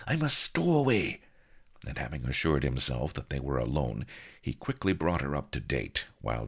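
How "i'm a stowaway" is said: urgent aside. A narrator's reading. Blood whispering urgently.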